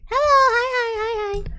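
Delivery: high, squeaky hellos; high-pitched sing-song hi; squeaky greeting. Real, not acted. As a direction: chirp a high hello to chat. Streamer greeting chat.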